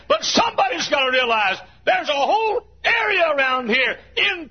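pulpit-pounding sermon voice; fiery preaching. Real, not acted. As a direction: preach it at full force to the congregation. Preacher building to full force.

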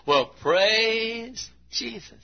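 high, slow drawn-out praise; imitating church member. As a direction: stretch it out like a happy old believer. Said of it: "So he'd say".

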